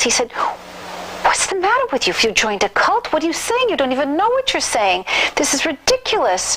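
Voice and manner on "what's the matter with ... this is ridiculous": sharp, high-pitched angry scolding; indignant husband berating his wife, much higher pitch; furious outburst. Retold or imitated. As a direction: snap at her, outraged and disbelieving. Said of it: "absolutely was furious with me, furious".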